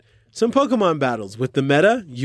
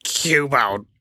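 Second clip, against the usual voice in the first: pull string toy; 3 semitones lower than usual. Pretend. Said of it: "That's right".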